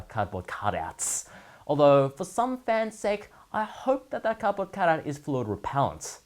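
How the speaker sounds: ordinary delivery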